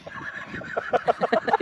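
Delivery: breathy burst of real laughter; laughing. Real, not acted. Fishermen cracking up on the trip, real laughter. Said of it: "क्यों हंस रहे हो".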